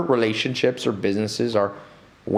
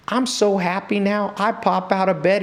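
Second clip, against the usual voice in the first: retold delight; 10 semitones higher than usual. Retells neighbor's delight at change.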